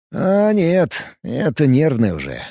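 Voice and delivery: plain reading voice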